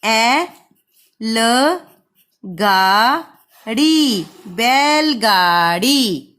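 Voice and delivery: very slow, syllable by syllable; slow spelling-out voice; sounding out slowly. Shown as a demonstration. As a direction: stretch each sound for the children. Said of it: "तो देखिए फिर ब को".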